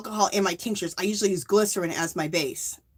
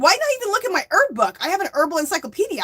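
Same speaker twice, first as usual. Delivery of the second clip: frazzled self-scolding; 8 semitones higher, faster than usual. Retold or imitated. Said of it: "when you're crazy 'cause you're sick".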